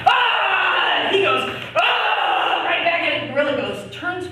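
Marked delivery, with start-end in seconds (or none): 0.1-1.1 loud, high mock yell; hammed-up scream from a brawl; acted fight scream. Acts out man and gorilla fighting. 1.8-2.6 high, loud mock scream; hammed-up cry of attack; acted fight yell. Acts out man and gorilla fighting.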